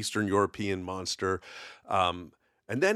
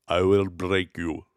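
Ivan Drago impression; 2 semitones lower than usual. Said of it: "Ivan Drago".

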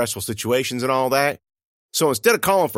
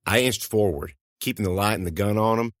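plain reading voice

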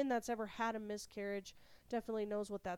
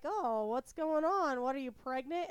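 nosy questioning; 4 semitones higher, 6 dB louder than usual. Retold or imitated. Relatives ask her about being pregnant, nosy. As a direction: imitate curious relatives fishing for news.

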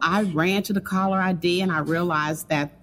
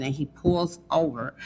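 ordinary delivery

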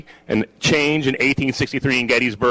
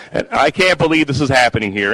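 normal talking voice

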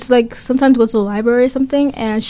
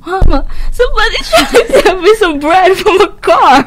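put-on higher, louder child voice, laughing through it; scared kid; 7 semitones higher, 5 dB louder than usual. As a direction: pretend frightened little kid telling mama. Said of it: "Imagine they went home".

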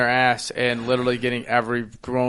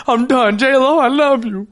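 higher, louder hammed-up devotion; mock lovestruck declaration; 11 semitones higher, 4 dB louder, slower than usual. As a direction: declare it to J-Lo like a swooning fan. Throwing ring off for J-Lo.

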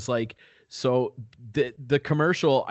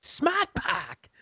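very high, drawn-out Boston catchphrase; thick Boston accent. Retold or imitated. Co-host relives favorite commercial, squeaky Boston accent.

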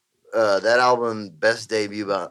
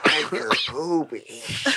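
movie character quote; 5 dB quieter, slower than usual. Retold or imitated. Quotes Little Nicky scene.

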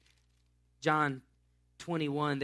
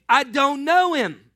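loud, higher, slower denial; a fisherman losing his temper; shouted denial; 9 semitones higher, 11 dB louder, slower than usual. Acted. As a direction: shout it back at her.

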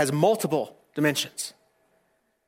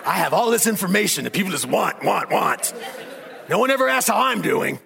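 laughing, aggrieved assistant voice; bitter Siri; 5 semitones higher than usual. Pretend. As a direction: play Siri as sulky and put-upon. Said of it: "Siri's bitter".

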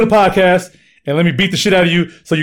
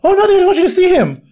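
much higher grandma voice; high mom voice; 4 dB louder than usual. Retold or imitated.

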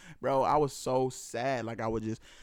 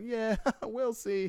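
high, drawn-out mimicry with a laugh; imitated knowing reply; 12 semitones higher, much slower than usual. Retelling a stranger's reply about Trump, amused mimicry. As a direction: stretch it slowly, pitched way up.

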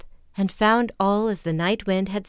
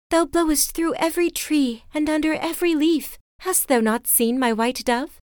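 much higher, imploring heroine; Lily, higher pleading; 8 semitones higher than usual. A narrator's reading. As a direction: call up to the wind. Lily pleads to night wind.

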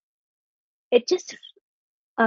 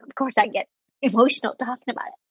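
ordinary delivery

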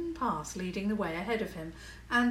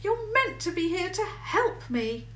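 high, sulky complaint; a little petulant; 11 semitones higher, 5 dB louder than usual. A narrator's reading. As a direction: whine at him a little. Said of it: "a little petulantly".

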